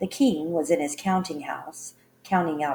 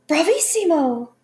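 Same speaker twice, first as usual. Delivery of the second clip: very high, loud cry of triumph; gleeful cry; 11 semitones higher, 9 dB louder than usual. A narrator's reading. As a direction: shriek it in wicked delight. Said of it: "The Queen cried out".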